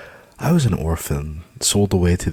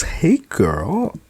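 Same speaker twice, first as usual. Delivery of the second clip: bright high cheerful greeting; cheery; 12 semitones higher, 6 dB louder, slower than usual. Acted. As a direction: sing it out sweetly. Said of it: "I cheered".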